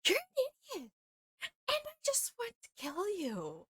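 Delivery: high mock snarl at the villain. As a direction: screech it out, way up high, in a mock rage. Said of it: "And I'm like".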